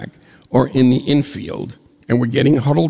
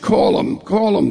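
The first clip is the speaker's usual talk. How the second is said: urgent; 8 semitones higher than usual. Retold or imitated. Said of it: "And he said".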